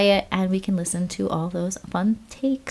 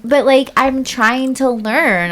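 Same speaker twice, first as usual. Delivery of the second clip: airhead voice; 5 semitones higher, 4 dB louder than usual. Pretend. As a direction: play the innocent wifey drawing out every word. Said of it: "You gotta do the airhead one day".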